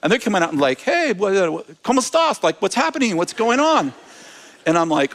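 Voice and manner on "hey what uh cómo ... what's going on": higher, lively calls of curious staff; excited office workers. Retold or imitated. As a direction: play the staff crowding out to ask what's up. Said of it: "these guys are really loud".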